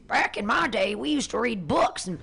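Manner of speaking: put-on old-timer voice. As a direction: preach like a crusty grandpa. Mocking an old-timer's "back in my day.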